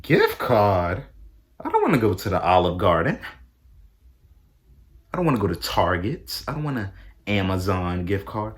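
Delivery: outraged. Retold or imitated. Outraged at getting a gift card.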